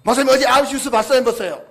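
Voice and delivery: loud, fast, worked-up asking; irate member asking about the news; agitated question. Retold or imitated. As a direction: rush it out like an upset church member. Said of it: "그 제보가 하는 말씀이".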